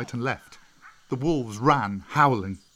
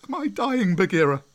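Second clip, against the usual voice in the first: much higher, panicked questions; frightened cry; 9 semitones higher, 6 dB louder than usual. A narrator's reading. Mowgli crying out in fear at his first tears, a high panicked voice. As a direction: cry out, scared and confused. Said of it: "he cried out".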